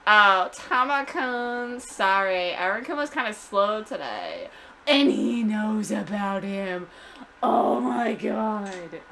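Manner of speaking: put-on long-drawn gushing Valley Girl vowels. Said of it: "like Valley Girl".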